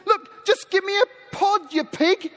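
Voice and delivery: high, mock-exasperated demand; losing patience. Hungry son demands food from the pig, acted impatience. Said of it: "lost son loses patience and he says".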